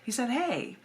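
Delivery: ordinary talk, nothing marked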